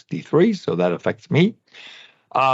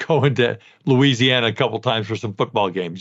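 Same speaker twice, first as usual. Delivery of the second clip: ordinary talk, nothing marked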